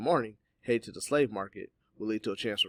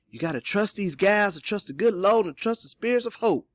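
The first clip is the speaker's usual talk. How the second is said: ordinary delivery, nothing marked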